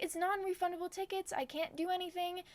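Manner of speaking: high-pitched plea, stressed; retold pleading. Stranded passenger pleads with the bus driver, retold pleading.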